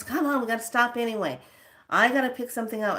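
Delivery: imitating a pushy friend, high and quick; impatient insisting. Friend insists on stopping anyway, impatient imitation.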